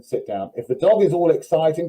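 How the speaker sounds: normal talking voice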